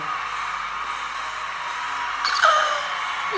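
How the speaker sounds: a long, breathless gasp of awe; high airy gasp. Real, not acted. Fan gasps at Tyra in pink. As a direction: gasp like your breath was taken away.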